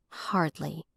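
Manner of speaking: low murmur to herself; under her breath. A narrator's reading. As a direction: mutter it barely aloud. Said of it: "she said under her breath".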